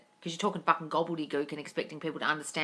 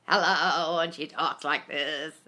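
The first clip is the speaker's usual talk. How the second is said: Katharine Hepburn impression; 2 semitones lower, much slower than usual. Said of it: "Katharine Hepburn impersonation".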